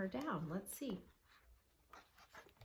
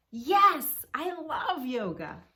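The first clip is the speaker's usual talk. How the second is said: high, much louder burst of joy; joyful; 6 semitones higher, 11 dB louder, slower than usual. Acted. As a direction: jump up shouting yes. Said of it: "filled with joy".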